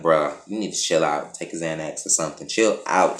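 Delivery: acted low-key exasperation; chill-out plea. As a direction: talk down a hothead, low and unimpressed. Telling raging man to calm down.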